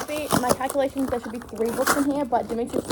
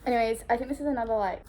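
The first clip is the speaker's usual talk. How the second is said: usual speaking voice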